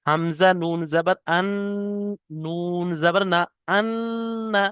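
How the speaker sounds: আন্না with the doubled ন held nasally, one alif long; ghunna on nun. Shown as a demonstration. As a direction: spell slowly, then hum through the nose on আন্না. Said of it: "নুনটা আমি পড়ে শোনাচ্ছি".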